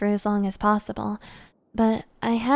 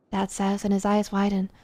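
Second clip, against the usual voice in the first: plain reading voice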